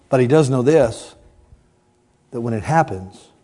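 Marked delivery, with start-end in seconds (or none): none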